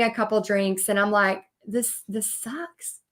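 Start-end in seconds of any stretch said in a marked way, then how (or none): 1.7-3.0 very soft, slow, deflated; defeated. Retold or imitated. At the bar after failing, soft, slow and defeated. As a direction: let it all drain out.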